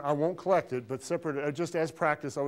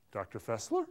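"Doctor Fessler" with a questioning rise at the end; low-key, asking inflection on "Fessler"; answer as a question; 4 semitones lower, 3 dB quieter than usual. Shown as a demonstration. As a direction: lift the end of "Fessler" as if unsure. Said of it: "what makes it sound like a question".

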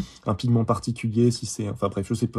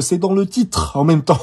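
slow, raised, self-mocking annoyance; mock exasperation; 6 semitones higher, 6 dB louder, slower than usual. Real, not acted. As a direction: huff at yourself, half laughing. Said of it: "Je m'énerve tout seul". (agacement contre soi-même, appuyé)

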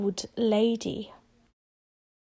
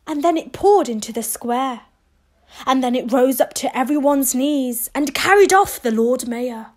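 usual speaking voice

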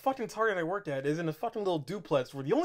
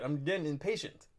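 normal talking voice

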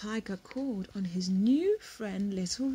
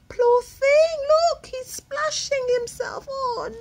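much higher, louder worry; alarmed concern; 10 dB louder than usual. Real, not acted. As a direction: cry out as he falls in. Alarm at tiger falling in.

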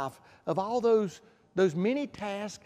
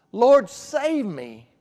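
crying out; 3 semitones higher, 7 dB louder, much slower than usual. Retold or imitated. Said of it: "if we'll cry out to Jesus".

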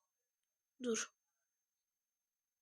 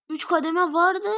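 louder, higher exasperated call; frustrated outburst; 5 semitones higher, 7 dB louder than usual. Real, not acted. Player frustrated at the game, louder exasperated shout. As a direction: blurt it out in frustration.

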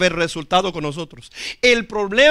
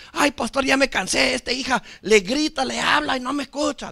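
higher-pitched imitated whine about a child; mock complaining parent; 5 semitones higher, 3 dB quieter than usual. Parent complaining to pastor about daughter, higher mock lament. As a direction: play a worn-out parent griping.

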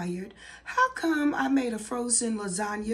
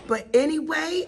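ordinary talk

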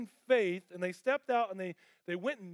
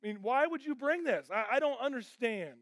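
anguished plea to God; 6 semitones higher than usual. Retold or imitated. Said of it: "And I'm like".

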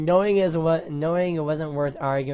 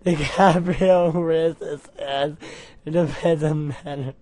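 ordinary talk

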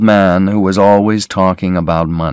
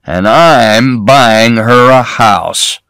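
put-on greedy old man; 2 semitones higher, much slower than usual. A narrator's reading. Cassidy showing off his daughter's photo, slow leering voice. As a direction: savour each word like a greedy old boaster.

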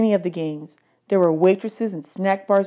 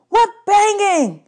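high, slow shouted question; hollered in a high voice; yelled; 7 dB louder, slower than usual. A narrator's reading. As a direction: yell it out from outside. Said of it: "Eddie yelled".

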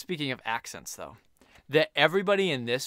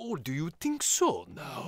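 slow, hammed-up Scottish accent; a clumsy attempt at Scottish; bad Scottish accent; 3 semitones higher, slower than usual. Doing a bad Scottish brogue for co-host. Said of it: "What about your Scottish?".

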